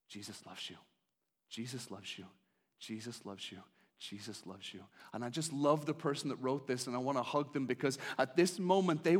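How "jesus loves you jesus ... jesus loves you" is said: soft, low, repeated like an echo; whispered refrain of the sign; hushed repetition. Pretend. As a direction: let it fall quieter each time. Sign's message repeated softly.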